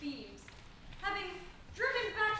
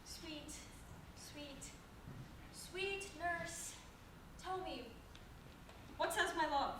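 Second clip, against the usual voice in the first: coaxing; 8 dB quieter than usual. Acted. Juliet coaxes nurse softly.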